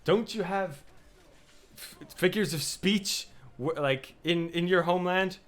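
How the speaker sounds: a hint of a high, taunting Odin; mocking Odin. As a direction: tease him like a smug god. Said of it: "and he's like".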